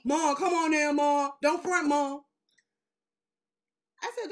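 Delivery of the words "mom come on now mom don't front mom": pleading son. Retold or imitated.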